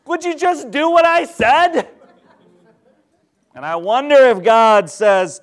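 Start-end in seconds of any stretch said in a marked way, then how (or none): 0.1-1.9 high, loud exasperated demand; mock exasperation. Rescuer loses patience with person in the pit, exasperated holler. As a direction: holler it down, hammed-up and fed up.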